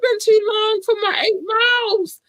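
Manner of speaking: high wailing fake sob; mock crying whine. Whining about missing her run.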